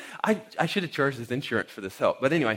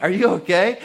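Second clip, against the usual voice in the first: loud, higher-pitched question; raised-voice concern; 5 semitones higher, 8 dB louder than usual. Retold or imitated. Asking the man caught in the door, loud concerned call. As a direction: call out to check on someone hurt. Retold check on fallen man.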